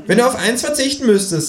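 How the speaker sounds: very high creature voice; kangaroo character. A narrator's reading. Kangaroo posing a hypothetical, very high-pitched. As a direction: pose it in a high cartoon animal voice.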